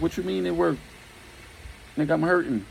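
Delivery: hammed-up hurt outrage; indignant protest. Hurt man objects after the fall.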